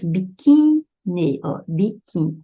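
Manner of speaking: "biquíni" com o QUÍ esticado; sílaba tônica destacada. Shown as a demonstration. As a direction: pese no QUÍ de "biquíni" e separe o NI. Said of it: "ela é paroxítona". ("biquíni" escandido, realçando a sílaba QUÍ)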